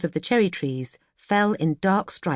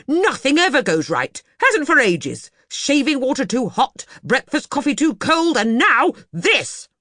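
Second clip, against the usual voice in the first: much higher and louder tirade, slowing at the end; angry rant; 9 semitones higher, 6 dB louder than usual. Acted. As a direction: list every grievance in fury. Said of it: "Mister Banks went on".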